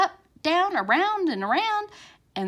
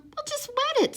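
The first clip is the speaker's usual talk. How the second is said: high mom voice. A narrator's reading. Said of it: "said Mom".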